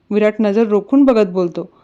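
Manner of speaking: plain reading voice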